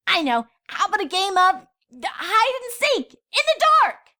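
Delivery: high, eager filly bursting with a plan; excited idea. A narrator's reading.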